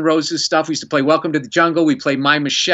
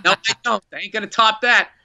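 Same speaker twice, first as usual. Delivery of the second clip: emphatic refusal; 5 semitones higher than usual. Retold or imitated. Acted refusal to go on stage.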